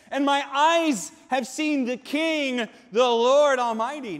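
freaked-out cry, high and loud; acted panicked confession, shouted out; Isaiah. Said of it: "He freaked out".

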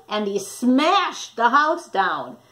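ordinary delivery

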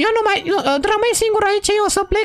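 squeaky high cartoon voice, fussing; a high, excited toy character scolding his friend. Same character, upset. (voce de personaj ascuțită)

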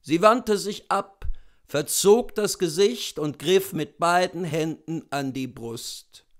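ordinary delivery

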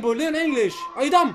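high, loud scornful jab; angry scolding. Real, not acted. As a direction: berate them, pitched high and loud. Angry insults to the caller.

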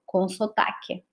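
dropped to a low murmur; soft translation aside; quiet aside. Real, not acted. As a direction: toss the Portuguese word in softly. Portuguese gloss, teacher aside.

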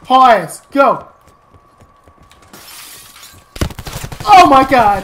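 loud, high urgent shout; panicked shouting. Real, not acted. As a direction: yell at yourself to run. Running from enemy, panicking.

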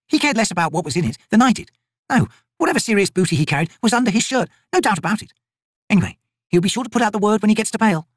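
female soldier voices. Acted. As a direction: rib your fellow soldier.

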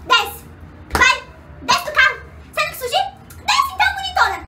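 angry mom. Retold or imitated. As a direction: imitate mom's furious taunt. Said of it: "ficou assim".

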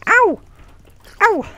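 very high loud ow; high yelp. Real, not acted. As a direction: shriek when hit. Hit by zombie.

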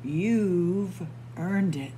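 slow, gentle goodnight; tender. A narrator's reading. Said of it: "said Emily".

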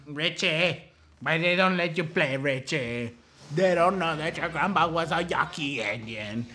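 imitated Mexican mother fussing; Mexican mom accent. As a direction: do a Mexican mom's accented complaint. Here's his mom.

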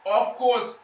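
loud, high, frustrated yelling; exasperated shout. Real, not acted. Gamer yelling at the screen after a conceded goal. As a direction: shout it out in disbelief.

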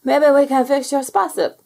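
a blobby eager to make up with a friend; hopeful. Acted. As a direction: cheer a friend up with a plan.

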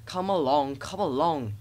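mildly fussy urging; fussing. A narrator's reading. Thomas hurrying the coaches, mild fuss. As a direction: chivvy them along in a repeated rhythm. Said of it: "he fussed".

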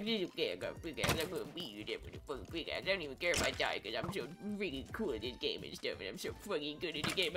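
low, soft, racing mumble; rapid trash talk sinking low as breath runs out; breathless rant. Pretend. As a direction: ramble fast on one running breath. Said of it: "I'm out of breath".

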